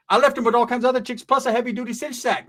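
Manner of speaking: ordinary talk, nothing marked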